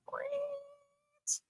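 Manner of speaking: faint, high drawn-out FRANCE; silly squeal. Pretend. Host laughing at the mistake, soft squealed FRANCE.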